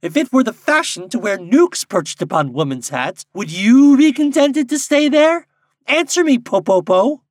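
high, loud challenge, climbing to a screech; screaming linnet. A narrator's reading. Linnet confronting Popopo, screaming indignantly. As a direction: demand an answer at full pitch. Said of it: "screamed a linnet".

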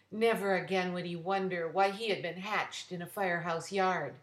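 usual speaking voice

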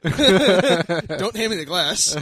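talking through laughter, slow; laughing. Real, not acted. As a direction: laugh the words out. Laughing while joking about glass.

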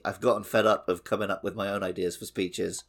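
ordinary talk, nothing marked

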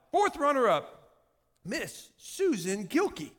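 slow, drawn-out results announcement; high, stately mock announcer; pageant announcer. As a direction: call out the runner-up like a pageant host. Acted pageant announcer calling results.

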